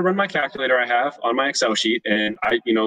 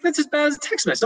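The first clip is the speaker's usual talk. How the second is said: scolding wife. Retold or imitated. Wife scolding husband over the voicemail firing, much higher. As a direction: play the wife telling him off.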